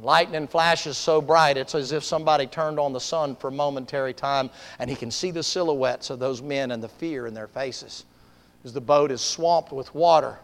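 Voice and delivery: low, softened preacher's narration; hushed sermon storytelling. Acted. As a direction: draw listeners into the scene quietly.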